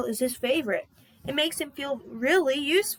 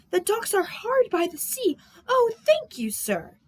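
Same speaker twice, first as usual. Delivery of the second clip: higher, delighted thanks; overjoyed sailor; 9 semitones higher than usual. Acted. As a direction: bubble over with joy. Salty overjoyed.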